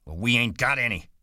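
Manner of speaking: exploding in anger. A narrator's reading. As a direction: snap back in exasperation. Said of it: "George exploded".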